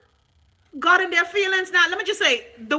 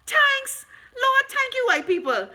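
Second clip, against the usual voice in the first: mock ecstatic thanks; 12 semitones higher, slower than usual. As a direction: squeal the thanks like a fake overjoyed believer.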